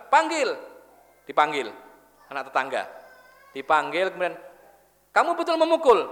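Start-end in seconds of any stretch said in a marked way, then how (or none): 0.1-0.5 higher, curt angry command; angry order. Retold or imitated. Angry mother orders the neighbour's boy brought, curt command. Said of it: "Ibu langsung marah". 5.1-6.1 much higher, louder accusing question; stern interrogation. Retold or imitated. Mother questions the neighbour's boy, loud accusing.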